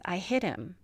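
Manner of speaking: softly retold in a mild daze; dazed. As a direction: imitate someone stunned, voice dropped soft. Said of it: "she sounds really dazed".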